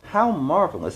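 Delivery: "How marvelous" gushed up high and bright; smarmy politician flattering the crowd; politician's voice. Acted. Mayor greets the angry townsfolk, oily and gushing. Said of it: "being a politician to the core".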